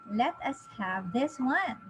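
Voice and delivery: ordinary delivery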